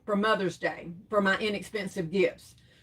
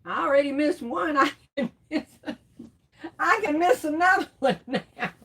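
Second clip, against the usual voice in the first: giggling through a retold thought, rising higher; laughing self-quote; 5 semitones higher than usual. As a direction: laugh as you admit the guilty thought. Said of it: "I'm like".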